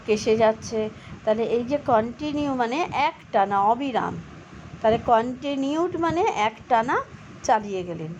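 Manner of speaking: ordinary talk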